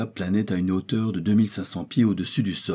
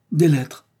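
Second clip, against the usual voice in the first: loud, higher excited cry; exclaiming; 7 semitones higher, 7 dB louder than usual. Acted. Hunter Kennedy spotting carved letters on the rock, crying out. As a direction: blurt it out at the discovery. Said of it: "s'écria Kennedy".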